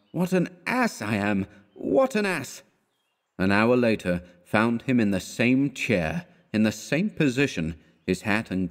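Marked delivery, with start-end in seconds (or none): 0.1-2.6 high, foolish self-reproach; imbecile smile. A narrator's reading. Man alone in his room calling himself a fool, imbecile smile. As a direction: climb higher on the repeat, like a smiling fool. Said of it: "repeating with an imbecile smile".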